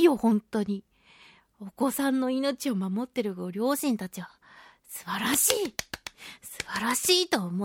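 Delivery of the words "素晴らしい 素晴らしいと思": awed whisper. Real, not acted. Heartfelt praise of parents.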